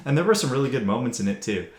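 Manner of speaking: ordinary delivery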